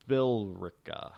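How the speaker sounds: Billrica drawn out slowly, billll-ricka; silent E. Shown as a demonstration. Host demonstrating for listeners, Billrica slowly with the E silent. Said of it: "The E silent".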